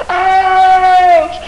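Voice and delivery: long, high, loud shriek; scream of pain. Acted. Son heard in hell's corridor, screaming in pain. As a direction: shriek out in agony. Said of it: "you hear him over here".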